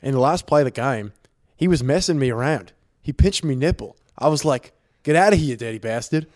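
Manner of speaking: put-on Australian accent; mock Aussie athlete retelling his grievance; Steven Adams. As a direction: read it out as an amateur Australian impression. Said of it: "rating my Australian accent, my Steven Adams impression".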